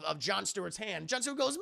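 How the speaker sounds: ordinary delivery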